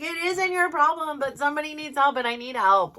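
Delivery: loud, high, exasperated plea; frustrated outburst. Retold or imitated. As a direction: snap at your daughter, fed up. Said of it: "and I got so frustrated".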